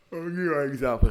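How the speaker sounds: talking through a yawn. Real, not acted. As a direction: yawn while speaking. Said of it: "Sorry for yawning".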